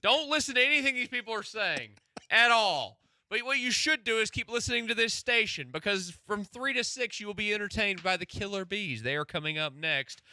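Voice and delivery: loud, high hype promo voice; radio announcer. Acted. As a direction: sell the next show like a DJ. Radio station promo read.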